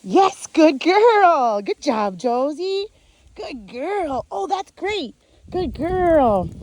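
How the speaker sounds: high, very slow gushing praise; big praise. Real, not acted. Trainer praising her dog big, high gushing praise. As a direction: gush over her, pitch up. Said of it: "I'm gonna praise her big here".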